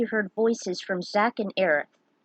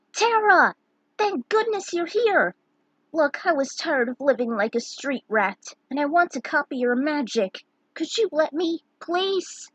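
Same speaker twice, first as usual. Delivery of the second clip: lightly voiced eager kid, high; a hint of a pleading street kid; eager youngster; 6 semitones higher than usual. Gao pleads with Terra.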